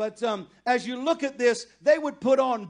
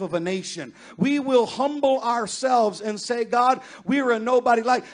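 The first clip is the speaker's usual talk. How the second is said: loud, fervent sermon climax, louder all through; full-force preaching; 3 semitones higher, 9 dB louder than usual. Acted. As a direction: build to a shouted, pleading prayer over the congregation.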